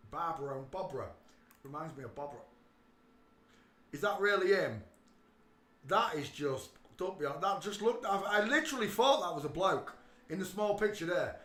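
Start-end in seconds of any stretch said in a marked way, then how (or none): 3.9-11.4 shocked disbelief. Real, not acted. Shocked at the younger photo.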